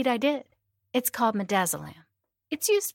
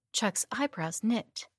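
ordinary delivery

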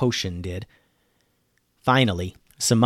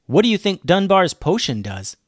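higher, questioning character voice; lightly voiced curious girl; 6 semitones higher, 3 dB louder than usual. A narrator's reading. Devney asking her sister about the potion, lightly voiced. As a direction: ask it with lifted pitch.

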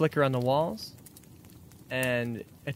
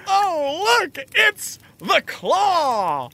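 terrified cry; 5 dB louder, much slower than usual. Acted. Cultist spots the Claw.

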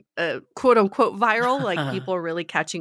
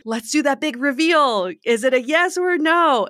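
hammed-up game-show reveal, high and loud; big reveal; 8 semitones higher, 6 dB louder than usual. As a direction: announce it like a dramatic reveal, pitched up. Acts out a dramatic big reveal.

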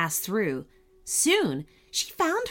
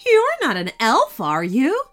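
suspicious; 5 semitones higher, 7 dB louder than usual. Acted. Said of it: "looking at her suspiciously".

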